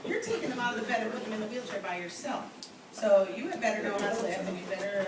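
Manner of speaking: usual speaking voice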